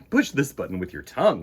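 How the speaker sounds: ordinary delivery, nothing marked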